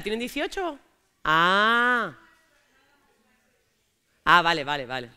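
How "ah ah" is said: ordinary talk, nothing marked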